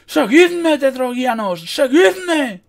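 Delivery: very high, loud rallying cry; all-out commander's battle yell; bellowing. A narrator's reading. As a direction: roar for the troops to follow. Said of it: "bramó, arrancando el estandarte".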